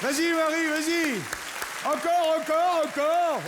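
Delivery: showman's excited applause call; cheering on. Real, not acted. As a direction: pump up the crowd for Marie. Cheering volunteer onto stage.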